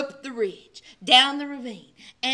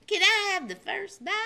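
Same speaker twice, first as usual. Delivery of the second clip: put-on eager child; 7 semitones higher than usual. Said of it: "drilled Wee Coyote".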